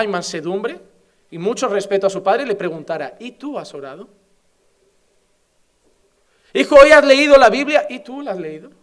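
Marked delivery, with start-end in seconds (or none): 3.2-4.1 quiet reply. Acted. Son answers father back quietly. 6.5-7.8 questioning father. Acted. Preacher voices questioning father. 7.9-8.7 quiet reply. Acted. Son answers father back quietly.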